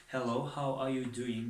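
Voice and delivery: "hello, how are you doing" spoken slowly, word by word, easy learner English; slow simple English. Shown as a demonstration. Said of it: "اگر با زبان یاد شما قطعی گپ زنم".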